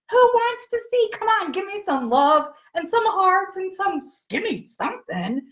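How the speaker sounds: high, eager call to the viewers; excited hype. Real, not acted. Host begging livestream viewers for hearts, excited and high. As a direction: rev up the crowd, pitch way up.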